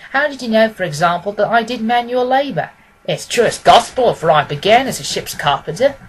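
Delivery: lightly voiced eager client. Pawnbroker asks Holmes how he knew, lightly amazed. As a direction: press him for the answer.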